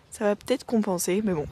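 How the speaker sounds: normal talking voice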